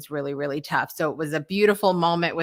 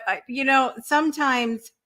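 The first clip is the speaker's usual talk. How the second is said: usual speaking voice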